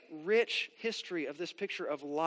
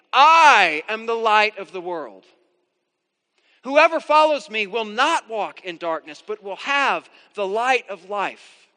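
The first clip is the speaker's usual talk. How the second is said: proclaiming as Jesus; 12 dB louder than usual. Acted. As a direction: announce it to the whole temple. Preacher voices Jesus' proclamation.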